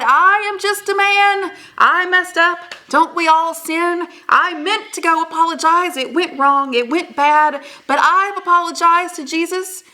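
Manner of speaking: a chief begging forgiveness, preacher style; chief preaching to his people. Acted. Koski addresses his people, pleading like a preacher.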